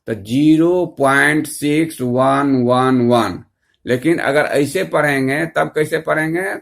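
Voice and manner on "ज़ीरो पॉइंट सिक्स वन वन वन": slow digit-by-digit reading, pitched a little low; पढ़कर दिखाना. Shown as a demonstration. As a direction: spell the number out slowly, digit by digit. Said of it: "तो कैसे पढ़ेंगे". (धीमे, एक-एक अंक अलग बोलकर)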